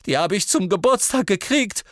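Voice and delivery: put-on high, loud lovesick boy; Ron voice, high and loud. A narrator's reading. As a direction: call it out high and desperate. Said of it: "rief Ron".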